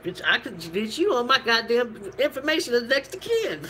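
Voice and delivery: high, indignant scolding of a friend; a hurt friend snapping back in disbelief; outraged retort. Retold or imitated. Angry retort voiced to friend.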